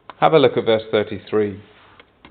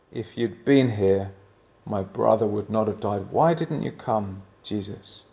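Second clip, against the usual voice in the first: hushed grief; 4 semitones lower, 6 dB quieter than usual. Retold or imitated. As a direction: let it sink low and quiet, grieving. Mary repeats Martha's grieving words.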